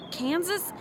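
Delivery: high, fast flustered question; panicked. Real, not acted. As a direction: rush it, voice jumping high. Unsure of her cover story.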